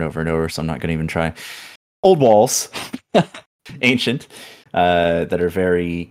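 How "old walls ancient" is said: big, booming description; dramatic narration. Acted. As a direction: announce it grandly. DM hams up dungeon description.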